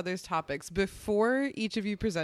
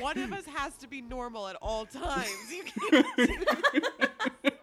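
laughing mock scold, higher at the end; 5 semitones higher than usual. Real, not acted. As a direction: scold through giggles.

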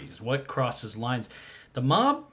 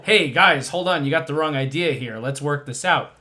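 louder, pleading to the guys; pleading mobster; 6 dB louder than usual. Retold or imitated. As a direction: beg them to work it out. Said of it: "There's no".

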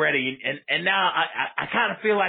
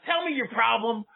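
ordinary talk

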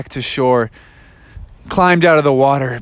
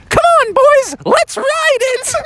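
much higher, slow and gleeful; high eager Daniel. Acted. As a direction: squeal it out like a reckless kid. Daniel Tiger eager to ride.

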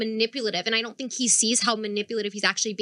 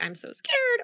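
scared aside; 12 semitones higher, slower than usual. Retold or imitated. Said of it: "talking to my regular".

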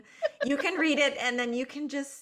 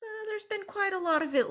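high-pitched attorney voice; 12 semitones higher than usual. Acted. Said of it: "high pitch for the attorney".

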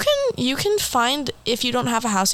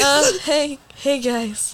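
radio host voice; 4 semitones higher, much slower than usual. Retold or imitated. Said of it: "I just pretend I'm, like, Radio Rebel".

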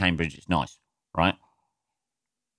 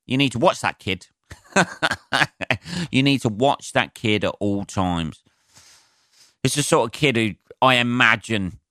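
ordinary talk, nothing marked

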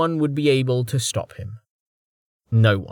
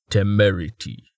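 satisfied rumble; 2 semitones lower than usual. A narrator's reading. Man naming his ship to himself, satisfied rumble. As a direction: rumble it to yourself, pleased. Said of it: "rumbled to himself in satisfaction".

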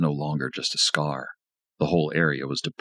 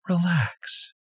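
Lisa comforting. A narrator's reading. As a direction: gently soothe him like a lover. Lisa soothes.

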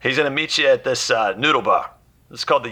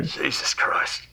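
exasperated mutter; 5 dB quieter, much slower than usual. Acted. Fixer exasperated again.

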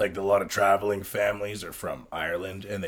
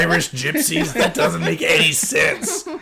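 laughing through the words, a bit louder; 6 semitones higher, 4 dB louder, slower than usual. Real, not acted. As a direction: laugh it out in disbelief.

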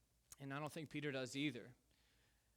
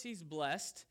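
ordinary delivery

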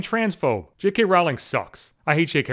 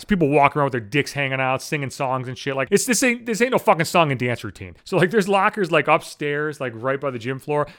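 usual speaking voice

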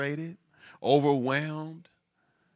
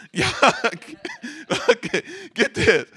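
laughing; 13 semitones higher, 3 dB louder than usual. Real, not acted. Laughing at the fired-then-ordered irony.

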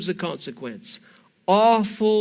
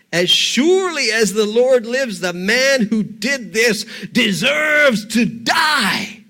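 shouted furious oath, drawn out slow; seething anger; 2 semitones higher, 5 dB louder than usual. Acted. David condemns the rich man to Nathan, shouting in fury. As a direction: let the rage burst out. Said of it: "David is seething in anger".

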